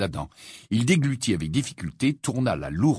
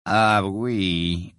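sepulchral voice. Acted. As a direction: speak like a voice from the tomb. Morose's gloomy voice.

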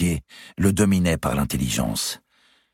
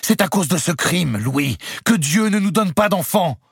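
high, loud outcry; exclaimed; 4 dB louder than usual. A narrator's reading. Clémence cries out against Louis, exclaiming. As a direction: cry out in protest. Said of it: "s'écria Clémence".

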